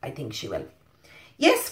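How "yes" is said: much higher squeal of Yes; cried yes. A narrator's reading. Witch gladly lets the bird on, high cry. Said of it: "cried the witch".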